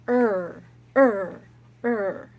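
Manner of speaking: loud jumping "Er" sounds; letter sound r. Shown as a demonstration. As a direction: push out "Er" with each hop. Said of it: "He goes".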